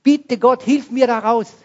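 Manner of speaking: high, loud cry for help to God; desperate scream. Retold or imitated. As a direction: scream it as if trapped by fire. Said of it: "habe ich geschrien". (verzweifelter, lauter Hilfeschrei zu Gott)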